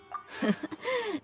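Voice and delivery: short chuckle to oneself; amused little laugh. Acted. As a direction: chuckle before teasing the boss. Amused companion teases the boss.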